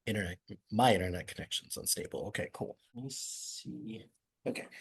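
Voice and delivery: real low, soft aside while troubleshooting; muttering to self. Real, not acted. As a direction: trail off into a barely audible mumble. Talking to self while checking connection.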